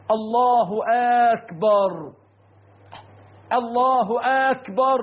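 الله أكبر with a long aa opening أكبر; hamza of akbar stretched. Shown as a demonstration. Preacher imitating some worshippers' mistake, long aa starting أكبر. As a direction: drag the first vowel of أكبر into a questioning aakbar. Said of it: "بعضهم ينطقها هكذا".

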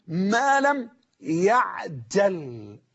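يعجل drawn out slowly, syllable by syllable; careful vowelling. Shown as a demonstration. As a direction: spell out يعجل slowly with a still ع. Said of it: "انظر معي في الضبط". (نطق «يعجل» ببطء مع ضبط الحركات)

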